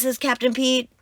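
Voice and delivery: ordinary delivery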